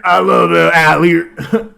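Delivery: a real laugh breaking into Allier; laughing through a fumble. Real, not acted. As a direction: laugh while trying the hard name. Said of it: "I can't say Allier".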